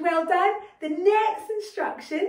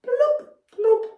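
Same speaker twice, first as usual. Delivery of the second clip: high, loud alien bloops; alien language; 9 semitones higher, 7 dB louder than usual. Shown as a demonstration. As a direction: chirp it out like a space creature. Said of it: "Everyone try saying hello".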